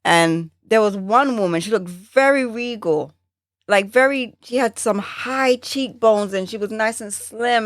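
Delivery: usual speaking voice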